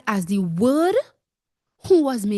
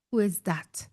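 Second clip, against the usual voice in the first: normal talking voice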